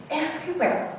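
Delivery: ordinary delivery, nothing marked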